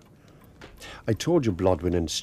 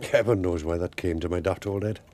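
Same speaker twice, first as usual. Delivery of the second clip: ordinary talk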